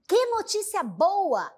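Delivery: delighted exclamation; exclaiming joy. Shown as a demonstration. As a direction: exclaim the good news. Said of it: "para expressar isso".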